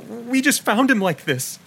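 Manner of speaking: high, slow, halting woman's voice; a frightened woman forcing the words out; lightly voiced shaken woman; choking on words. A narrator's reading. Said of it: "She choked on her words".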